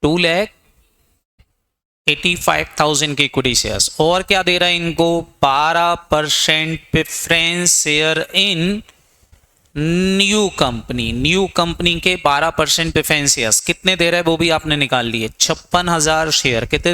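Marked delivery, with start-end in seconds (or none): 5.4-11.1 slow dictation. Shown as a demonstration. Teacher dictating notes to students.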